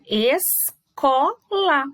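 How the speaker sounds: "Escola" bem devagar, ES, CO, LA, com pausas; sílabas separadas. Shown as a demonstration. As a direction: separe "Escola" deixando ouvir o S e o C. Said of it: "eu consigo ouvir o som do S e". ("Escola" silabado, cada som nítido)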